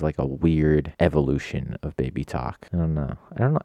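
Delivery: normal talking voice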